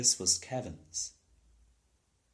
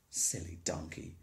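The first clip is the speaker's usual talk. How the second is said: softer, lower scornful aside; muttered scorn; 7 semitones lower, 7 dB quieter than usual. A narrator's reading. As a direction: brush off the donkey under your breath. Jamie scorns the donkey.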